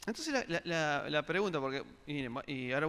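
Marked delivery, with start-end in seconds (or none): none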